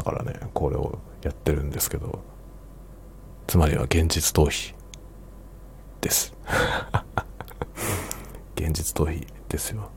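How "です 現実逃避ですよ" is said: whispering. Real, not acted. Whispered joke to himself.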